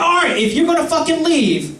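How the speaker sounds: high, irritated agreement; grudging, heated concession; exasperated giving in. Acted. Acted giving in to Acid.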